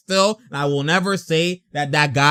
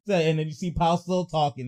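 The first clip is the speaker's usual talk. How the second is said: ordinary delivery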